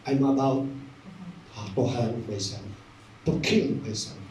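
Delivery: low, halting and increasingly soft; subdued confession. Retold or imitated. Old man confesses despair to pastor, subdued. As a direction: confess it heavily, with pauses between phrases.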